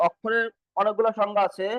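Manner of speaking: ordinary delivery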